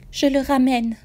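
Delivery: insist, rising and loud; eerie insistence. A narrator's reading. Ghost mother insisting she'll bring him back. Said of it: "continue-t-elle".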